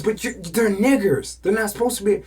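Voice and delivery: high, loud mock outrage; imitated angry protest. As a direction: object hotly, acting him out. Man protesting in acted quarrel.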